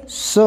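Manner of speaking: "स" as suh, not ess; phonic sound of S. Shown as a demonstration. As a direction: sound out "स" with a neutral vowel. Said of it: "यसचा उच्चार आहे".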